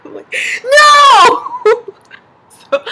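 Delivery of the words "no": sudden high yelled no, near tears; frustrated no. Retold or imitated. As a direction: blurt it out, high and loud, half crying. Refusing deodorant offer in frustration.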